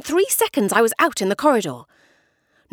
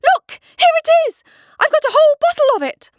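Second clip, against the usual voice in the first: much higher, louder, breathless triumph; excited boy; 8 dB louder than usual. A narrator's reading. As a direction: jump and shout it to grandmother.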